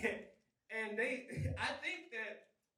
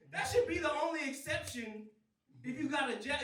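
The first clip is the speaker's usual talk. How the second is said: ordinary delivery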